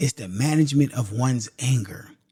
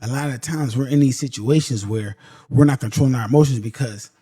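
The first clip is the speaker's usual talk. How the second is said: ordinary talk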